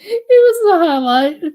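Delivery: high loud delighted crow; gleeful laugh. Real, not acted. Laughing about roach prank.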